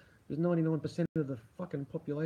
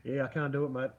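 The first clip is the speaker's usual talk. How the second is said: ordinary delivery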